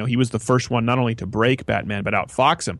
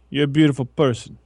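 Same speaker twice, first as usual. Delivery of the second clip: Kojak impression; 2 semitones higher than usual. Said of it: "Like Ko-, Kojak".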